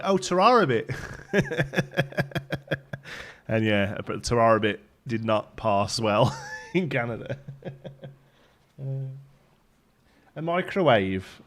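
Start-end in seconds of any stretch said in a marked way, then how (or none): 0.0-0.9 higher, louder Brummie "tara rabbit", run together as ta-RAH-a-bit; Brummie goodbye. Retold or imitated. Streamer realising what the boss misheard, bright-eyed Brummie recognition. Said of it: "And I was like". 10.3-11.4 "microwave" drawn out slow and higher in Brummie; Brummie accent. Shown as a demonstration. Streamer showing off a favourite word, slow Brummie accent. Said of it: "good word to say in Brummie".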